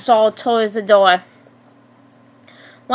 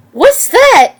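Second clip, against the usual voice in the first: louder, much higher tough-guy question; tough guy attitude; 10 semitones higher, 5 dB louder than usual. A narrator's reading. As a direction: demand it like a tough guy, pitched up. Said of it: "asked with a tough guy's attitude".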